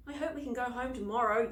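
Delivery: ordinary delivery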